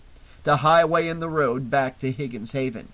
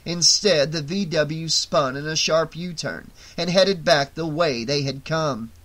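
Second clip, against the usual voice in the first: usual speaking voice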